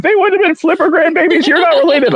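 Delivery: shrill impression of mom correcting; high mock mom voice. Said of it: "And she's like".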